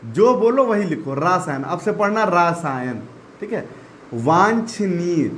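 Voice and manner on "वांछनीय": वांछनीय very slowly, nasal वां clear; slow careful word. Shown as a demonstration. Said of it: "वांछनीय में बिंदी होती है".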